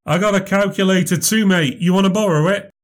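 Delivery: heckler taunt. Acted. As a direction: jeer at him like a smug lad. Heckler mocking offer.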